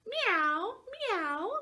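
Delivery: high meowing cry; crying cat. Acted. Parent reading the story, cat cries a high meow. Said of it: "cried the cat".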